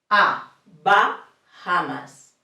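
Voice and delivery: A then Bahamas said slowly, open a; Spanish A. Shown as a demonstration. Teacher repeating the A example. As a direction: draw out Bahamas to model the vowel. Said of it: "sounds very similar to the Spanish".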